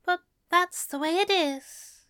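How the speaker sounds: high, gentle character voice, mildly sad. A narrator's reading. Alistair explaining his publishing deal to Crowley, sadly. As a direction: explain it with resigned regret, pitched high. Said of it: "Alistair said sadly".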